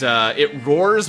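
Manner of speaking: ordinary talk, nothing marked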